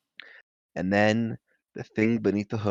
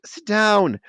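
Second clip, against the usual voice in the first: put-on bossy boy; 11 semitones higher, 5 dB louder than usual. Ron voiced.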